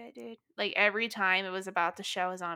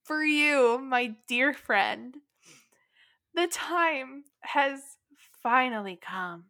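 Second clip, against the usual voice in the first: slow, drawn-out grand announcement, pitched up; mock-solemn proclamation; 4 semitones higher, 4 dB louder, slower than usual. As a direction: proclaim it slowly like a momentous reveal.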